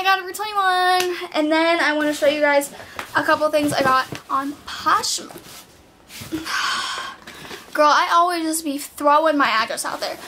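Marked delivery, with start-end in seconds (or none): 6.1-7.7 long breathy sigh. Real, not acted. Vlogger pausing mid-haul, a long sigh. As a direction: let out a long exhale. Pause while filming haul.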